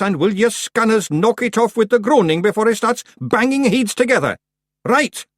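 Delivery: high, loud Scots wee-man voice; high Scots pictsie. Acted. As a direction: lecture the clan like a cocky wee chief. Rob explains husbandry.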